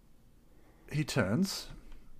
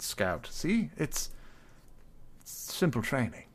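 courteous, slightly higher character voice; polite adventurer; 5 semitones higher, 7 dB louder than usual. Acted. Adventurer asking his eagle to scout the town, polite and fussy. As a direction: ask the bird politely, a little fussy.

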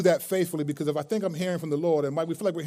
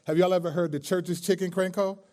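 ordinary delivery

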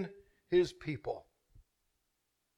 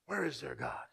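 much softer, lower echo of the taunt; whispered echo; 8 semitones lower, 15 dB quieter than usual. Acted. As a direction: let it fade to a hush. Echoed softly.

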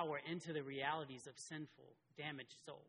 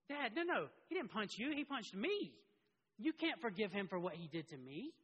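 put-on higher and louder, an outraged little boy objecting; protesting child; 5 semitones higher, 4 dB louder than usual. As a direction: play the wronged kid crying foul to dad. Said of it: "He would go".

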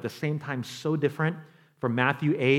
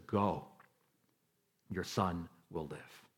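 calm quiet command; 11 semitones lower, 15 dB quieter, slower than usual. Retold or imitated. Jesus quietly answers the father.

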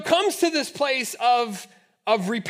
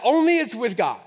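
ordinary delivery

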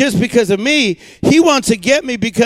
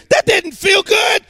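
incredulous; 9 semitones higher than usual. Real, not acted. Rhetorical question about pain.

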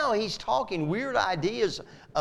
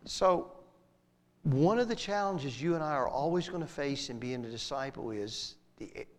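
normal talking voice

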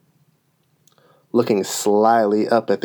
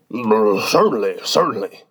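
muttering. A narrator's reading. King answers the unicorn's demand for cake, muttering. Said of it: "the king muttered".

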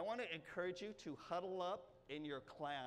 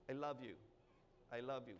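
tender; 8 semitones lower, 7 dB quieter, faster than usual. Pretend. Voicing tender words to family.

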